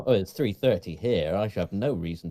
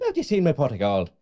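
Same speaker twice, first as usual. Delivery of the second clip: put-on higher, louder stage Irish accent; hammed-up leprechaun Irish; 5 semitones higher, 5 dB louder than usual. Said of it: "That's not at all a good thing".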